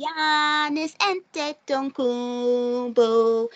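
slow arena-style player call; drawn-out name call. Pretend. Fan echoing the chant she wants added to the section, drawn out slowly. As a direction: stretch the name out long.

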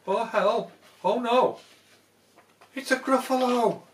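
high, loud panicked cry; frightened cry. Acted. Mouse shrieking in fright on meeting the Gruffalo. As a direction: yelp it out in sudden fright.

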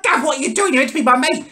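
high, aggrieved retold plea; indignant complaint. To mate who pulled him out, high aggrieved complaint. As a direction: protest high-voiced, feeling let down.

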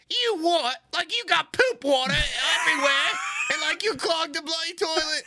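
put-on high shouted British accent; angry British woman shouting at him. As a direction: yell in a scolding British voice. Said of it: "the British woman's just like".

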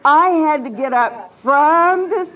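ordinary delivery

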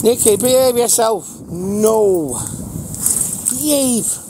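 stern dog scolding. Real, not acted. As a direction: sharply tell the dog to behave.

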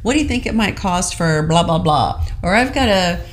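ordinary talk

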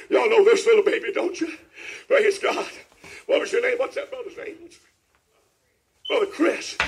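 normal talking voice

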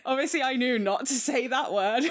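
high, giggly disclaimer; laughing. Real, not acted. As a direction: talk through leftover laughter. Laughing after the rude joke.

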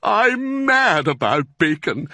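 high, slow, loud gusto; excited cry. A narrator's reading. Badger answers Fox's offer, crying out excitedly. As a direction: burst out with greedy delight. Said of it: "dancing with excitement".

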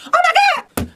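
very high, loud mock squeal; hammed-up shriek of delight; retold excited squeal. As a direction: squeal it in falsetto like an overjoyed fan. Retells joy at grabbing the box.

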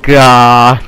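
very loud, drawn-out curse; frustrated yell. Real, not acted. Gamer cursing his own mishap, frustrated yell. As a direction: bellow it in frustration.

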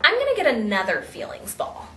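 ordinary delivery

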